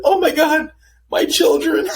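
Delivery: mock horrified friend. Imitating his homegirl horrified at the dog hating her. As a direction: shriek in mock horror through a laugh. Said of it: "She's like".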